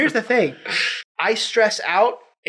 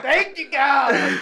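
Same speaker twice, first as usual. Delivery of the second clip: 1 relieved thanks; 6 semitones higher, much slower than usual. Retold or imitated. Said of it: "I'm like".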